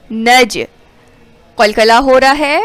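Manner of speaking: ordinary delivery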